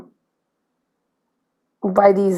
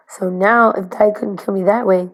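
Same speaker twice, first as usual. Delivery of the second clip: ordinary talk, nothing marked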